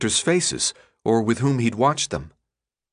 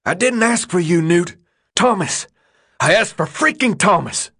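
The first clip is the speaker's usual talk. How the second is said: angry shout; 9 semitones higher, 4 dB louder than usual. A narrator's reading. Albie keeps yelling at Newt.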